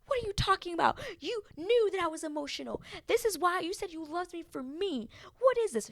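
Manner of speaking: high, exasperated mock rant; upset outburst. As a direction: imitate a girlfriend going off at dinner. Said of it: "Lydia was going off, being like".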